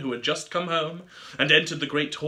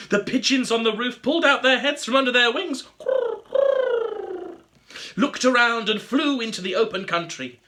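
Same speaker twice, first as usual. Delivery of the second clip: lively waking; 7 semitones higher, 5 dB louder than usual. A narrator's reading. Everything wakes, lively reading.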